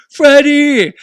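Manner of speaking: retold full-voiced, high-pitched yell. Fan chasing the artist through the stands, yelling. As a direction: holler the name after someone far ahead. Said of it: "I'm yelling".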